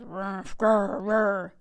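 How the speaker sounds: grumbling bear grunts and growls; muttered growls. A narrator's reading. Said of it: "Old Bear muttered and growled".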